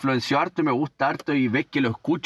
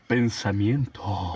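lower, slow put-on voice; low slow character voice; 7 semitones lower, much slower than usual. Imitating a TV character.

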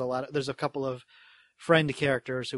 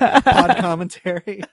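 slow, loud laughing coinage; 5 semitones higher, 9 dB louder, slower than usual. Shown as a demonstration. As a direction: savor it slowly through a laugh. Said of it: "I do podcast commentary".

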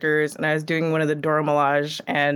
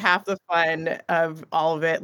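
ordinary delivery

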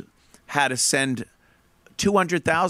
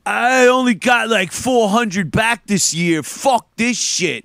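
higher, slow whiny mock complaint; whiny complainer; 5 semitones higher, 3 dB louder, slower than usual. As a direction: sulk about a small refund, dragging it out. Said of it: "they sit there and go".

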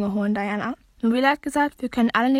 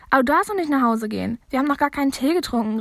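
ordinary delivery, nothing marked

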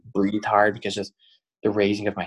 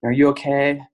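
raised, higher mock yell of alarm; retold alarmed shout; 6 semitones higher, 5 dB louder than usual. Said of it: "like, scream".

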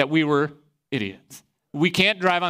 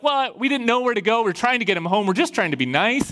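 higher-pitched mock pleading; imitated defensive excuse-making, pitched up; 6 semitones higher than usual. Students giving the cop excuses for driving, pleading.

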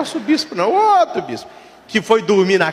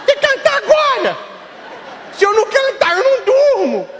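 put-on insistent little boy; 14 semitones higher than usual. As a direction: demand it like a stubborn kid. Boy pleading to bishop.

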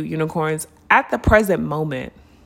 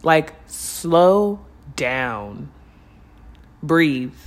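stretched way out, slower still; very slow drawn-out Like, slow down with a gentle rise; 3 semitones lower, much slower than usual. Shown as a demonstration. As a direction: draw out every sound of slow down. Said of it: "the message that I'm getting intuitively is".

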